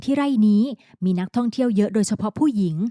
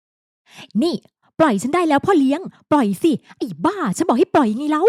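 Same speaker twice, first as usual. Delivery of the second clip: higher, insistent scolding; angry demand; 6 semitones higher, slower than usual. A narrator's reading. Woman carried into the office, demanding angrily to be released. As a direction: order him to let go.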